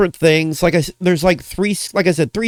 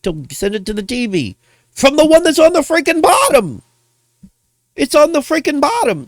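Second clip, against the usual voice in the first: exasperated rant; 6 semitones higher than usual. Real, not acted. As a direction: scold a clueless commentator in a raised pitch. Ranting at another commentator's mistake.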